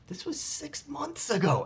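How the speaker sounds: high, slow mock disbelief; incredulous. Actor answering producers who called back months later, incredulous. Acting out disbelief at late callback.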